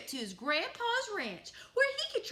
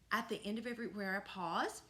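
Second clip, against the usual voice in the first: ordinary delivery, nothing marked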